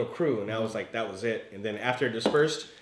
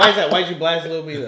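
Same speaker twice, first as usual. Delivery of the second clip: loud, higher, laughing demand; laughing challenge; 3 semitones higher, 5 dB louder than usual. Real, not acted. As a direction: tease him through real laughter.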